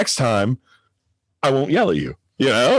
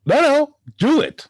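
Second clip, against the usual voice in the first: urging; 13 semitones higher, slower than usual. Pretend. Eggs parent on to follow through.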